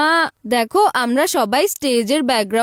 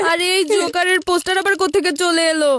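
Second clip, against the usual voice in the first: shocked dismay; 4 semitones higher than usual. Acted. Girl finds joker poster.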